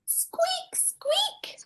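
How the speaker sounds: very high tiny squeaks, the second whispered; a little mouse peeping. Acted. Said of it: "said Mouse".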